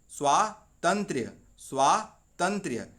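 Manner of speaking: "स्वातंत्र्य" slow, with a clear final य; teacher spelling out "स्वातंत्र्य" with the य sounded; careful pronunciation. Shown as a demonstration. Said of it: "स्वातंत्र नहीं होता". ("स्वातंत्र्य" धीरे, आख़िरी य साफ़)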